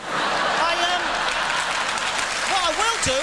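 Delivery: high, loud push through the laughs; over the laughter. Real, not acted. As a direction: raise your voice over the crowd. Recovers after crowd laughter.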